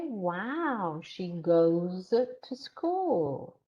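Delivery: GOES drawn out with a swooping pitch; impressed echo, slowly stretching GOES; corrective recast. Shown as a demonstration. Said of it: "repeat the sentence, but in the correct format".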